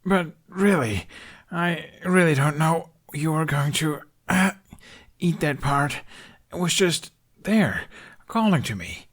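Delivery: put-on a pinned boy pleading between breaths; young Hiccup, winded. A narrator's reading. Hiccup pleads with Toothless, still catching his breath. Voices Hiccup under the dragon.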